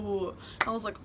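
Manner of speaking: ordinary delivery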